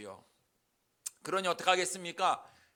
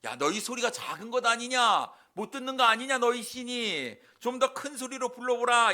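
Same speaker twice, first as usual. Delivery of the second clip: raised, higher-pitched jeer; mocking taunt; 3 semitones higher, 4 dB louder than usual. Elijah jeering at the prophets of Baal, mocking. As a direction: taunt them with pushed-up voice. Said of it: "선지자들을 조롱하기 시작하지요".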